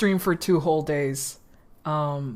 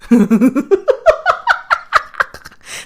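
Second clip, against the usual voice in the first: high, loud burst of laughter; 10 dB louder than usual. Real, not acted. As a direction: laugh hard at your own joke. Laughing after the joke.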